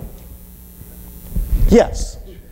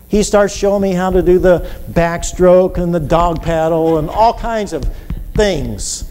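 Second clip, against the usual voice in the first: usual speaking voice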